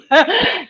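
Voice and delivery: loud, high burst of laughter; amused laugh. Real, not acted. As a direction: laugh out loud at your own joke. Laughs at her own joke.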